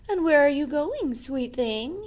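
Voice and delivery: lightly voiced sly wolf; butter her up as the wolf; charming wolf. The wolf speaks.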